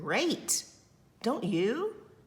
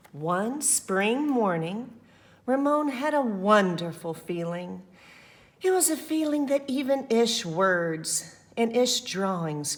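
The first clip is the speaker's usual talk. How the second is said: lively, louder read-aloud voice; story narration; 5 dB louder than usual. A narrator's reading. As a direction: read it to the children with energy.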